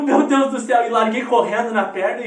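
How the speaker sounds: put-on loud, urgent replay of a child's alarm; retold panic. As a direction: exclaim it as if bolting after mum. Retold panicked dash after mother.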